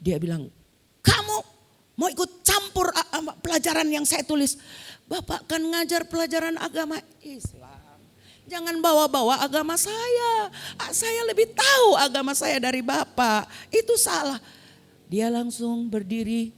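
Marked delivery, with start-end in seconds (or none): 1.0-4.6 high-pitched indignant rebuke; retold outburst, pitched way up; angry teacher. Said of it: "emang dia marah. Dia bilang". 5.0-14.4 high, indignant comeback; acted defiant argument at high pitch; heated retort. Pupil argues back at angry teacher.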